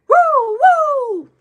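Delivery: high, loud siren whoop; imitated police siren. Driver imitating the police pulling her over, siren whoops. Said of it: "And what did I hear?".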